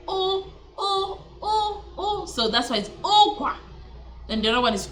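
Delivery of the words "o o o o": "o" sounded high and held, vowel alone; accented U demonstration. Shown as a demonstration. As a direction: repeat a high, isolated "o". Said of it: "with the accent is".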